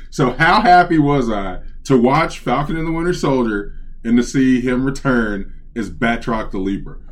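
usual speaking voice

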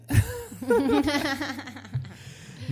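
long, high-pitched burst of laughter. Real, not acted. Co-host reacts to the spelled-out name, high laughing.

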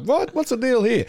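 high-pitched mock bewilderment; puzzled, pitch leaping up in disbelief; baffled reaction. Host recalling their confusion at an episode, mock baffled. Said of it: "the episode we were like".

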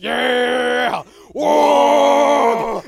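high, very loud whooping cheer; mock cheering shout. Orc players celebrating their spoiler win, wild whooping shout. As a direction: whoop like a rowdy crowd.

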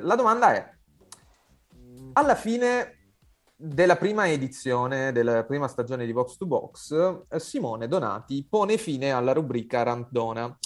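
showy game show host. Acted. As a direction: pose the question with game show flair. Quiz host poses question.

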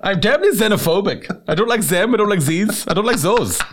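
high, hammed-up German accent; mock German accent. Joker playing a xenophobe, high mock German accent. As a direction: put z for th like a comic German. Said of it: "in a German accent".

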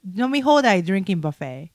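ordinary talk

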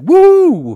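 loud, high joyride whoop; big playful holler; imitated whoop. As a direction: whoop like a thrilled driver.

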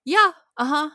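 mock Mark. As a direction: brush it off in a squeaky voice. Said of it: "Mark's like".

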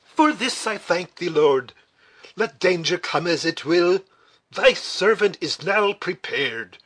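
put-on exclaiming like an old Scottish officer; Scottish accent. A narrator's reading. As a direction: give thanks aloud in a Scottish accent. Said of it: "exclaimed in the peculiar accent of Scotland".

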